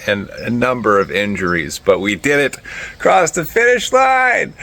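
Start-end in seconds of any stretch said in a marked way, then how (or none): 3.0-4.5 triumphant shout. Real, not acted. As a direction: shout it like you just finished. Just finished the race.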